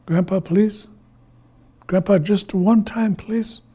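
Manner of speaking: small, hopeful begging; pleading. Acted. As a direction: beg Grandpa gently. Iktomi begs Grandpa.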